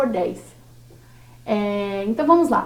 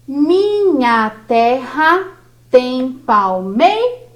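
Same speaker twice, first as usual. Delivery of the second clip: very slow, syllable by syllable; scanning the verse slowly, syllable by syllable; slow scansion; much slower than usual. Shown as a demonstration. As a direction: draw each syllable out to count it. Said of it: "nós vamos começar pela primeira".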